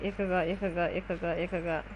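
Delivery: ordinary talk, nothing marked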